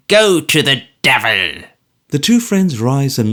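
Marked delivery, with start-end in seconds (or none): 0.1-1.6 put-on old man's louder, venomous curse; Smallweed telling George to go to the devil; spiteful curse. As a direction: spit the curse at him.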